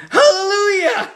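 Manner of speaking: very high, loud cry of Hallelujah; joyful scream. Retold or imitated. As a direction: shout it out in pure delight.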